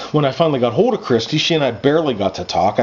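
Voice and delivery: plain reading voice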